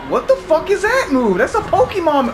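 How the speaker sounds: high loud disbelieving yell; shocked shout. Real, not acted. Monster's strange move.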